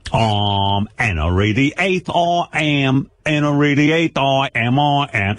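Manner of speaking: very slow, drawn-out Cockney British; hammed-up British music-hall recital; British accent. Host shows his guest it's fun, hammed-up British accent. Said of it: "It's fun to play British".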